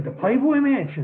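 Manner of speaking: high, loud incredulous echo; amazed disbelief. Retold or imitated. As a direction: replay your own shock at the invite. Retold disbelief at Playboy invite.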